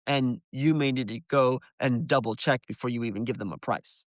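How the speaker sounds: usual speaking voice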